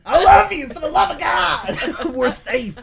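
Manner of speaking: high, loud mock-furious affection; aggressive loving yell. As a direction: bellow love like a scolding, hammed-up. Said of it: "You aggressively yell".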